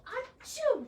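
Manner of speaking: high loud fake sneeze; a big hammed-up achoo. Rabbit sneezes.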